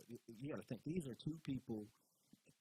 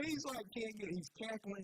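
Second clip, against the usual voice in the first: laughing; 9 semitones higher than usual. Real, not acted. Laughing while describing Billy.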